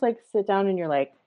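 ordinary delivery

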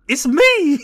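high, loud comic exclamation; mock excited cry. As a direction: shout it like a goofy cartoon reveal. Acts out the pictured character's cry.

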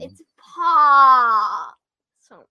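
drawn-out wail. Acted. Kid wails the pun.

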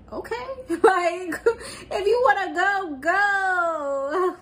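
high, laughing dismissive "if you wanna go, go"; mock careless brush-off. Recapping a show, voicing her waving him away with a laugh. Retold dismissive reply to ultimatum.

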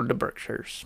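breathy awed hush; hushed reverent whisper. Pretend. Mock local whispering the Berkshires. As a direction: whisper the name like a secret. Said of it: "someone's gonna say".

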